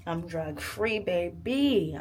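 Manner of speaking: loud celebrating cheer; excited. Real, not acted. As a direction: belt out the victory. Celebrating end of medication.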